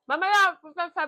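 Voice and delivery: loud sudden call; shouting. Real, not acted. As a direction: shout it across the house. Shouting to someone. (malakas na sigaw)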